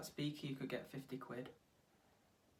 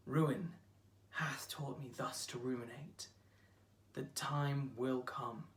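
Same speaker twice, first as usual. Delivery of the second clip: slow, subdued sonnet reading; poetry recital; 3 semitones lower, slower than usual. Acted. Actor reciting fear of losing a love, slow and grave. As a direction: let the verse sink, with long pauses. Sonnet turns to personal loss.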